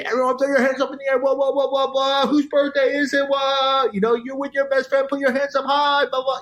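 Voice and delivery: high, fast mock hype-man shouting; club DJ impression voice. DJ imitating a club DJ hyping the crowd, high mocking voice. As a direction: rattle it off like an over-the-top club hype man. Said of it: "Like the club D J".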